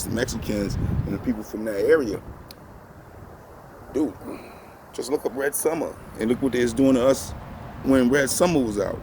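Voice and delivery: ordinary delivery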